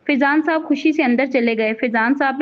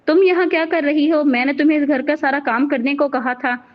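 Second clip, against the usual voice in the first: plain reading voice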